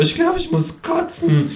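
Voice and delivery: very high, queasy squeak; high-pitched disgusted kangaroo; nauseated kangaroo. Acted. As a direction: squeal as if about to be sick. Kangaroo doubled over, disgusted.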